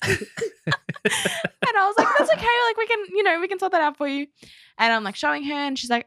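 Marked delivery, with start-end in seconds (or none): 0.0-4.3 laughing. Real, not acted. Laughing while retelling.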